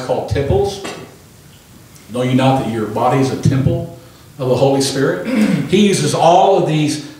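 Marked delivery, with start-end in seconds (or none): none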